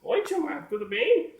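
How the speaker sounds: very high doll voice; squeaky-high puppet hello; little puppet greeting. Acted. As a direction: pipe up as a puppet greeting each friend. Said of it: "ela tem que olhar pra mim".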